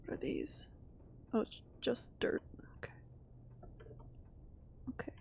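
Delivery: hushed whisper to herself; whispered relief. Real, not acted. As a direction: breathe it out nervously. Nervous gamer exploring alone.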